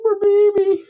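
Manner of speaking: high sing-song pity; pitying high coo; mock pity. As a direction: coo it like to a hurt child.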